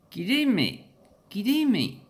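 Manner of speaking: high, loud mock New Zealand accent. Shown as a demonstration. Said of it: "They probably pronounce it like that".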